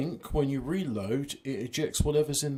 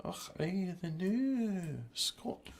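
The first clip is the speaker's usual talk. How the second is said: higher, hammy Scottish brogue with och aye; a jokey Highland laird; gruff scottish lord; 3 semitones higher, 4 dB quieter than usual. Pretend. Plays a Scottish local.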